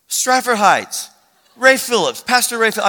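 loud, high, building recognition; excited recognition. Retold or imitated. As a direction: imitate someone thrilled to place a face. Stranger recognises the pastor.

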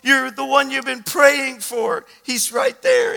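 high, slow, overcome cry; loud, stretched-out emotional outburst; Judy crying. Retold or imitated. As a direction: imitate a tearful grandmother wailing with joy. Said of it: "she's crying".

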